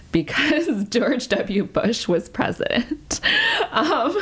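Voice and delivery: laughing through the words, pitch up; real amused laugh breaking in. Real, not acted. Guest recalls Texas politics to interviewer, laughing. Laughing at George W. Bush memory.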